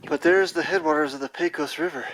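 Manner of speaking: usual speaking voice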